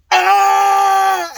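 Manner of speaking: loud high anguished yell; imitated yell. Imitating a friend's outburst during the finale, a loud high yell. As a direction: belt out a friend's pained yell. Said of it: "I made that exact same noise".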